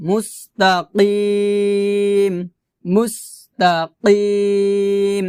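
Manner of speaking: slow "মুস্তাকিম" with throaty q and long ee; "মুস্তাকিম" recited slowly, q from the back of the throat; deep qaf sound. Shown as a demonstration. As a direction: stretch the last syllable of "মুস্তাকিম" after a qaf. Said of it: "এখানে হচ্ছে কফ".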